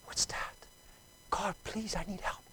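very soft, small cry for help; hushed retold plea. Lost man praying as a rumble approaches, hushed. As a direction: murmur a prayer under the noise.

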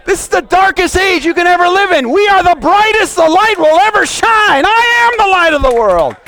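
high, sustained impassioned shouting; full-force preaching. Real, not acted. Preacher rousing saints as light of the world, shouted preaching. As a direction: proclaim every line at the top of the voice.

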